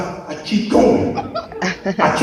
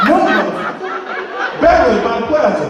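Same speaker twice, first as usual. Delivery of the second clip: incredulous mock outburst; 9 semitones higher than usual. Telling a friend off for a dumb plan, laughing incredulous. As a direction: scoff at the idea, laughing.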